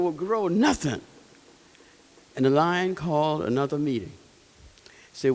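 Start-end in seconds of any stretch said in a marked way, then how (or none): none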